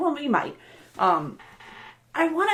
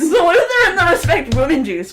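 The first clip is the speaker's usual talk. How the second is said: laughing as she repeats it; 7 semitones higher, 7 dB louder than usual. Real, not acted. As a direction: say it through a laugh.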